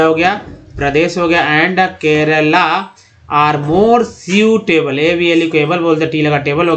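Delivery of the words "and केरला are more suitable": slow reading. Shown as a demonstration. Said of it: "reading the example sentence slowly".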